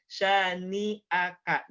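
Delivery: "syani'aka" slow, syllable by syllable; correct, separated syllables. Shown as a demonstration. Teacher spelling out the fix, slow separated "syani'aka". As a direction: break cleanly before "a" in "syani'aka". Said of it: "tapi syani'aka".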